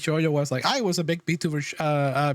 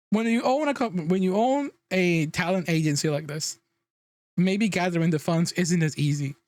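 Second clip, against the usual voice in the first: ordinary talk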